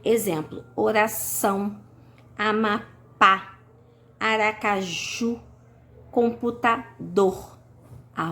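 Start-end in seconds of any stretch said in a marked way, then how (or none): 0.8-7.6 "oração", "Amapá", "Aracaju", "computador" com a última sílaba separada e acentuada; última sílaba forte. Shown as a demonstration. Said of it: "última sílaba é a mais forte". (tônica final destacada em "oração", "Amapá", "Aracaju", "computador")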